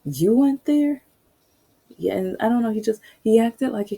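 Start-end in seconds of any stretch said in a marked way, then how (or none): 0.0-1.0 high, loud mock disbelief; hammed-up shocked doubt; imitating a cop who can't believe it; disbelieving cop. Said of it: "He was like".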